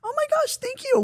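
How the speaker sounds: very high-pitched, delighted squeal; gushing thanks. Retold or imitated. Thanking someone who says hi, high gushing delight.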